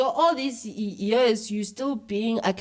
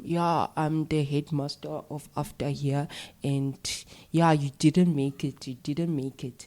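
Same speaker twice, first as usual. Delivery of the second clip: lower, mock-official Afrikaans accent; bad Afrikaans voice; 4 semitones lower than usual. Cousin posing as headmaster to give bad news, mimicked Afrikaans accent. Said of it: "Who's making this bad Afrikaans voice".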